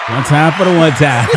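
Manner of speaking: loud hype shout to the room. Real, not acted. Cohost hyping the story up with a loud shout. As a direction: call it out loud for the crew.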